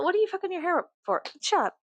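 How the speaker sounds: high squeaky mock voice, then snapping back; mocking imagined critic. Answering imagined viewers, a high mocking voice. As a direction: squeal the nagging question high and fast. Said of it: "And if you're".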